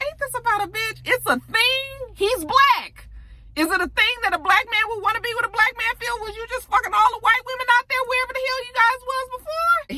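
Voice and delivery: shrill-pitched exasperated rant at the TV; incredulous outrage. Retold or imitated. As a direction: rant at the screen in high disbelief. Her own outraged reaction while watching.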